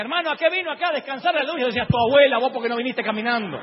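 high, loud irritated retort; comic exasperated yelling; indignant outburst. Acted. Heated comic exchange acted out.

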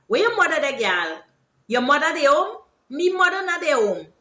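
animated Guyanese Creolese chat; Creolese example. Shown as a demonstration. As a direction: act out a Guyanese Creolese exchange. Acts out Creolese example exchange.

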